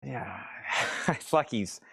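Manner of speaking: a laughing, lightly voiced low Clint; Clint impression, laughing. Seller mocks Eastwood for chat, low, laughing. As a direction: slip into a low quiet growl and crack up. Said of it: "He's like".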